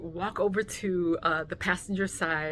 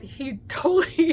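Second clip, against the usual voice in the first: usual speaking voice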